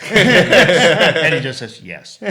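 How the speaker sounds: very slow words through loud, high laughter; barely getting words out for laughing. Real, not acted. Laughing at Spock's yes.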